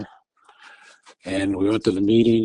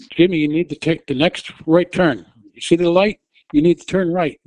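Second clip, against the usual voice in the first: higher, louder, insistent; urgent directions; 6 semitones higher, 4 dB louder than usual. Retold or imitated. Wife steering her husband up the hill, raised urgent voice. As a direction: press each instruction on him.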